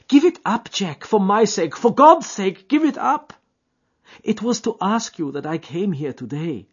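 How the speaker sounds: high, loud desperate pleading; begging girl. A narrator's reading. Etty kneeling, begging Jack to quit, high desperate plea. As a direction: beg him on your knees.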